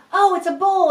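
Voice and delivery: finally got it, share the joy; delighted guess at a kid's drawing; delighted discovery. Shown as a demonstration. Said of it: "finally you go".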